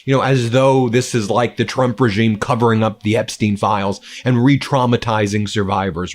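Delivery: ordinary talk, nothing marked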